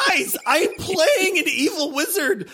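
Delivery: high, loud laughing protest; half-laughing cry of disbelief; excited laughing outburst. Real, not acted. Laughing outburst over game choice.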